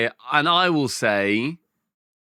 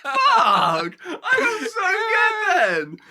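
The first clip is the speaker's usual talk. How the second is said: high, slow laughing cry of joy; laughing shout; much slower than usual. Real, not acted. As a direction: crow it out, delighted. Thrilled at nailing challenge.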